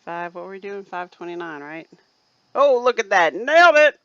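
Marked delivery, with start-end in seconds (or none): 2.6-4.0 much louder, higher cheer of triumph; triumphant shout. Real, not acted. Host cheering her perfect measurement. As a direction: crow it out, thrilled.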